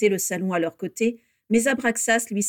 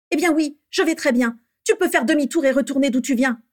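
loud, high, curt brush-off; irritated dismissal; 7 semitones higher, 6 dB louder than usual. A narrator's reading. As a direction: send him packing. Bellatrix angrily dismisses Rodolphus. (renvoi agacé et sec)

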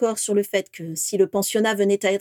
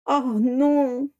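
moaning; 5 semitones higher than usual. Acted. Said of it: "gémit Vera".

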